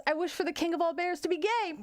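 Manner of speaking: high-pitched cartoon rabbit; little joke rabbit. Rabbit making a wish to the genie, high little voice. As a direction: play a small rabbit, pitch well up. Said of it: "And the rabbit puts on his little helmet".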